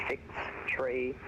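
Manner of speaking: ordinary delivery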